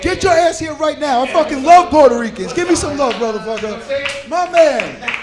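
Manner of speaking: loud, high-pitched, thrilled yelling; excited shout. Real, not acted. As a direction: shout with joy at a fellow Puerto Rican. Excited greeting of Puerto Rican fan.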